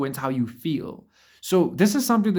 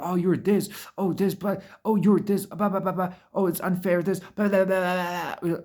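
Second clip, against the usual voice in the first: higher, whiny mock nagging voice; a higher mocking voice for the nagging critic; mocking complainer; 5 semitones higher than usual. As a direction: sneer out the critic's nagging blah blah. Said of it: "bitch at you and be like".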